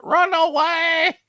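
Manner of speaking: high, drawn-out mock panic shriek; mock scream. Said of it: "he's screaming".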